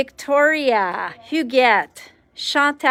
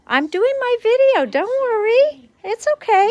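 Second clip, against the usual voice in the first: high reassuring voice; 11 semitones higher than usual. Real, not acted. Reassuring someone off camera.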